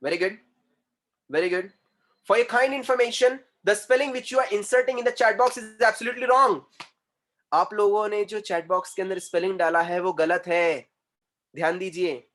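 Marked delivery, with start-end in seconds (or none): 2.3-6.6 raised, higher-pitched teacher's announcement; emphatic correction. Real, not acted. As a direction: declare it forcefully and deliberately. Teacher loudly corrects students' answers.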